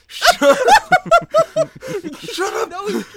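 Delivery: very high, loud shriek through laughter; laughing shout. Real, not acted. Player begs joking friend to stop, laughing shout. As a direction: barely get it out while cracking up.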